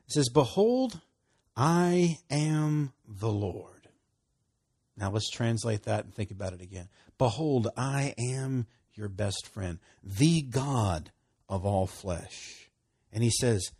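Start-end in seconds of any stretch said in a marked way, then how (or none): 0.3-3.9 scripture reading. Real, not acted. 7.2-12.7 slow paraphrase. Real, not acted. Re-reading paraphrased scripture.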